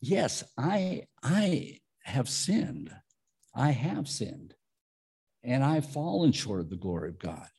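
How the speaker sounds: softly spoken, measured admission of sin; hushed, understated confession; solemn confession. Acted. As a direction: confess it quietly, with pauses between phrases. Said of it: "it's me saying".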